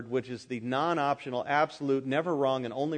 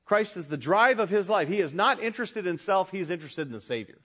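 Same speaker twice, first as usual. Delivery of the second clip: ordinary talk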